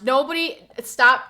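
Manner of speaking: loud, pleading stop; exasperated yelling. Real, not acted. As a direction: beg them to stop, loud. Begging chat to stop yawn.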